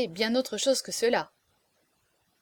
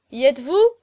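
loud, raised questioning call; shouted call; 4 semitones higher, 10 dB louder than usual. A narrator's reading. Young marquis checks that his performers are ready, shouting. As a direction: call out to the whole band. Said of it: "criait en ce moment Chaverny".

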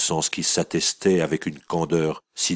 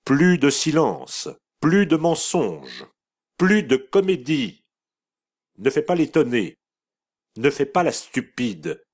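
martelé, aigu, lent et fort; dure et sévère; 9 semitones higher, 4 dB louder than usual. A narrator's reading. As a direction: chaque «plus» frappé comme un verdict. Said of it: "ajouta la dure mère". (mère devenue juge, reproches martelés à sa fille)

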